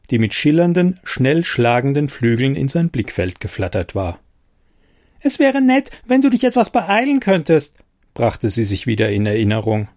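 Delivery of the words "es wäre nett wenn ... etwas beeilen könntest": much higher, squeaky and impatient; a tiny fairy hurrying him along. Acted.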